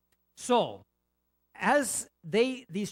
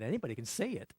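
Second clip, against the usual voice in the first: usual speaking voice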